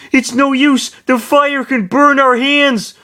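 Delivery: loud, slow, very high wail; yell. A narrator's reading. Roy with burning hands, high yell. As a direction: shriek it high in despair. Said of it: "Roy yelled".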